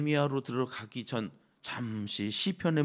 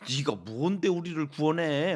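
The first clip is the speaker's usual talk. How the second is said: scornful jeering; 4 dB louder than usual. Acted. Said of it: "이스라엘 백성들까지 비웃어요".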